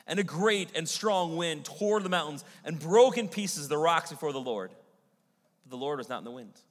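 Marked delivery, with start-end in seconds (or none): 0.1-4.7 loud, swelling scripture reading that booms out. Acted. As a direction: let the wind roar through the verse. Reading of the mighty wind.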